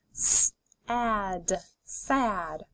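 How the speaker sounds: d, ot, dot, parts pulled apart then joined; D, ot, dot: each part separate, then the whole word; segmented then blended. Shown as a demonstration. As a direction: sound it out and blend it. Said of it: "Let's keep going".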